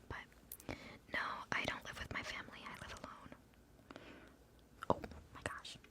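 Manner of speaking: hushed whispered chat with viewers; fully whispered, easygoing rambling; ASMR whisper. Real, not acted. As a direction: whisper casually, as to a livestream audience.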